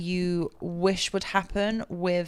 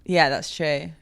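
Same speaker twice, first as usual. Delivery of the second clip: usual speaking voice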